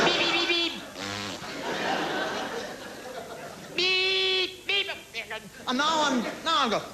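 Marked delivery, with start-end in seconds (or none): 0.1-4.9 high nasal beep like a car horn; imitated car horn beep; mock horn beep. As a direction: toot it like a horn. Beeping back at driver.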